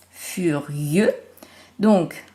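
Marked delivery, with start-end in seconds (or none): 0.1-1.1 "furieux" low and drawn out, fuu-rieux, rising at the end; stretched syllables. Shown as a demonstration. Teacher isolating rieux for pupils, "furieux" slowly segmented. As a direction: separate "furieux" into two held syllables. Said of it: "dans la syllabe rieux".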